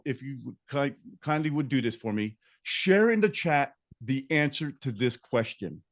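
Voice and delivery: ordinary talk, nothing marked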